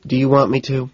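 a touch of hardness; without softness. A narrator's reading. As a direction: ask it with no tenderness. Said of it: "Without a trace of softness".

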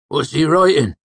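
high, loud rustic question; curious old villager. A narrator's reading. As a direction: squint and ask, curious and loud. Cobb asks about stranger.

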